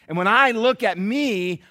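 ordinary talk

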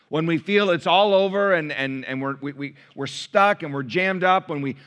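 forceful pulpit build; impassioned preaching. Real, not acted. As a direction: drive each phrase home to the congregation.

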